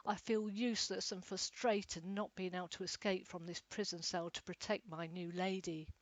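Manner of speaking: usual speaking voice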